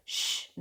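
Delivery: breathy unvoiced shh; a sharp whispered shh; hushing shh. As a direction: hush her sister quickly. Hushing excited sister.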